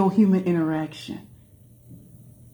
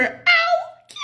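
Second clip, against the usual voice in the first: surprised. Real, not acted. Reacting to a comment.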